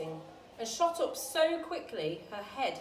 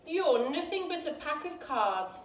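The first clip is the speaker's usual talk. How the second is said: defiant retort; 6 semitones higher, 5 dB louder than usual. A narrator's reading. Alice defies the queen.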